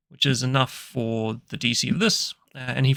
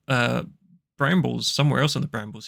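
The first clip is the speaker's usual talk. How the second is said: usual speaking voice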